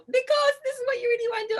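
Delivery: high, loud, fast, with a crying break; the pushy inner voice, half-sobbing. Retold or imitated. Comic voicing the inner voice that pushed her, high and tearful. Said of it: "this voice in me was like".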